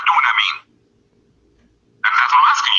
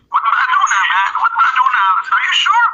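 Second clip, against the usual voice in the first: ordinary talk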